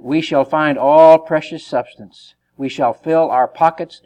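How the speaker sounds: loud, slow, emphatic reading; forceful scripture reading. Acted. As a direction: proclaim the verse slowly and heavily.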